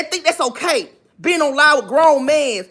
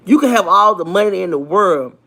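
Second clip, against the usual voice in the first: ordinary talk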